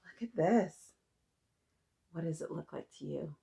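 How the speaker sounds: usual speaking voice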